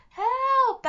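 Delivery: piercing high scream for help. Acted. Alex trapped in the net, screaming for rescue. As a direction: scream it at the top of your lungs. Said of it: "Alex screamed".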